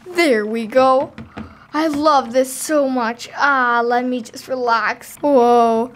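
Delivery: normal talking voice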